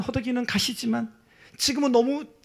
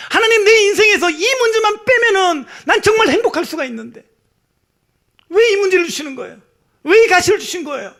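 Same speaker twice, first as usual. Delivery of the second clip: high, loud, tearful pleading; crying out to God; 10 semitones higher, 9 dB louder than usual. Retold or imitated. Paul pleading with God through tears, crying out. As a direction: wail the prayer like Paul in tears. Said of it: "막 울부짖었을 거예요".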